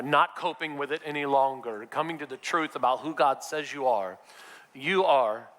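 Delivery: ordinary delivery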